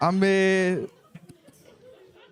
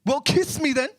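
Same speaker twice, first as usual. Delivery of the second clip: London roadman; 9 semitones higher than usual. Acted. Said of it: "And then he went".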